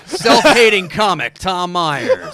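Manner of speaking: booming slow announcer intro. Pretend. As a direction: announce yourself like a big-stage MC. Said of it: "Do it again".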